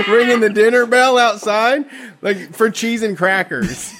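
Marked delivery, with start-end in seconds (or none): none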